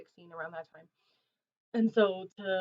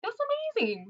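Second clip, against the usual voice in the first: excited squeal; 4 dB louder, faster than usual. Real, not acted. Excited reaction to viewer support.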